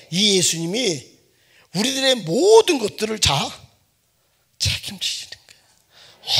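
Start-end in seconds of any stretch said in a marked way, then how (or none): 4.6-6.4 slow hushed whisper. Acted. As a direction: whisper it like a secret. Hushed emphatic point. (천천히 속삭이듯)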